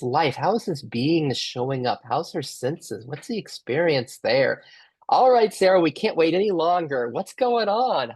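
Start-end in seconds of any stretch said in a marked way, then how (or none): 5.1-8.1 eager call-out. Real, not acted. Host eagerly calls Sarah in.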